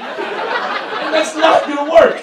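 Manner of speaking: high, loud, frantic rant; exasperated rant. Retold or imitated. Boyfriend panicking at his happy girlfriend, loud high rant. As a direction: fire it back in a panicked outburst. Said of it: "I'm like".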